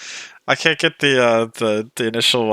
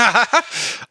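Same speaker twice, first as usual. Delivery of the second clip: short, higher burst of laughter; amused laugh; 10 semitones higher than usual. Real, not acted. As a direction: laugh in surprise at the accent. Amused by friend's accent.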